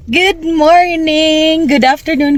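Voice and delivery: high, slow drawn-out hello to viewers; vlogger greeting. Acted. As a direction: stretch the welcome out like a show host. Vlog intro greeting. (mataas at mabagal na pagbati ng vlogger)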